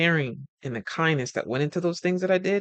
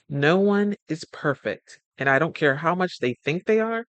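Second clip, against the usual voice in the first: ordinary talk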